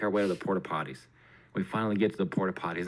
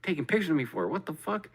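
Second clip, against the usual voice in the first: freaked out; 7 semitones higher than usual. Retold or imitated. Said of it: "He's freaking me the fuck out".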